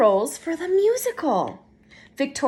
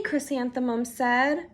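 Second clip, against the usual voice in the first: plain reading voice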